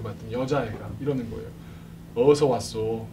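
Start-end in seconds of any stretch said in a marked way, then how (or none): 2.1-3.1 Jeolla dialect. Retold or imitated. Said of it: "그 포스가".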